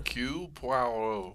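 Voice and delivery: soft, French-style "Hercule Poirot"; French name demo. Shown as a demonstration. Co-host showing how the name is said, French pronunciation. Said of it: "can you say it?".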